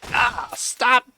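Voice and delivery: mock-pained high whine of protest; exasperated plea. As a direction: beg them to stop in a high, strained voice. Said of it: "and I'm like".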